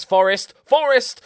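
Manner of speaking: loud, high excited yell; shouting the team name. Real, not acted. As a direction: shout it at the screen as the chance comes. Cheering own team's attack.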